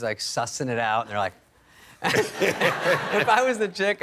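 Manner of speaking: usual speaking voice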